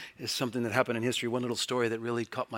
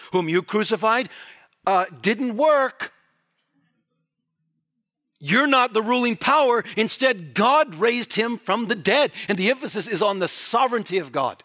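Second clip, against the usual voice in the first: loud, high, impassioned preaching; full-force preaching; 10 semitones higher, 10 dB louder than usual. Acted. Preacher thundering at the congregation about the court, loud and high. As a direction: drive it home to the congregation, full voice.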